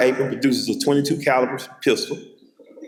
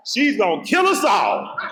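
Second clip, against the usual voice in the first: mock panicked shout; 7 semitones higher than usual. Terrified child tells cousins.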